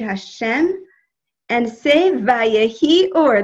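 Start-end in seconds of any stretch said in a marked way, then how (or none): 2.1-3.4 a hint of a firecracker aunt, loud and drawn out; slow, loud proclamation imitating an aunt; Aunt Celia. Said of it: "This is what my Aunt Celia used to say".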